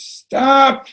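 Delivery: high, piercing scream of one word. Retold or imitated. Rabbi halts the Kiddush across the room, screaming. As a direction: scream it to halt everything at once. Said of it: "Rabbi Leibel screams".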